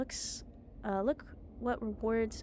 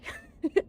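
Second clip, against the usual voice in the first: gloating laugh; 7 dB louder than usual. Acted.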